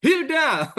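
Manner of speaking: high, loud mock call; playful yell. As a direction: yell the name as a joking rebuke. (teriakan pura-pura memanggil)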